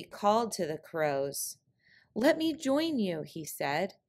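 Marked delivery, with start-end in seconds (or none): 2.1-3.2 high, eager coyote plea; trickster tale voices. Acted. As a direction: lift it up, pleading to join. Coyote calling to the crows.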